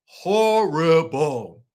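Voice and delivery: "Horrible." stretched out slow and heavy; drawn-out horrible. Real, not acted. Host repeating her verdict on the friends, drawn slow. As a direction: drag "Horrible." out slowly, letting it sink in. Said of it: "I just gotta say it like that".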